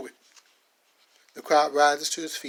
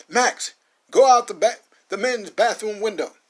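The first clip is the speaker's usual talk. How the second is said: high, loud push to flee; urgent command; 6 semitones higher, 9 dB louder than usual. Acted. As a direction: hurry him out the window. Bishop urges escape.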